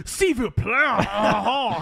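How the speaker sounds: high snooty French voice with a cackle; mock French teacher. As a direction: sneer it like a pompous Frenchman. Repeats teacher impression.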